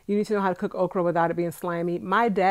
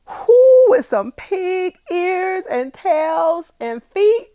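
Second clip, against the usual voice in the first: very high, loud, slow and crying out; ecstatic whoop; 13 semitones higher, 10 dB louder, much slower than usual. Real, not acted. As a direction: whoop with delight. Delighted about Bahamian food.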